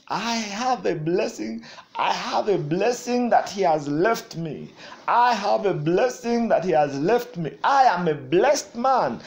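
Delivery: loud, fervent preacher declaring; full-force preaching. Acted. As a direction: proclaim it to the congregation.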